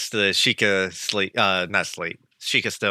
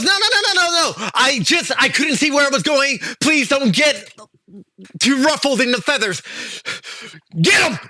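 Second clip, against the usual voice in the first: panicked pleading; 13 semitones higher than usual. Acted. Bird begging the angry householders, high and panicked. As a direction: plead desperately not to be hurt. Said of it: "She goes".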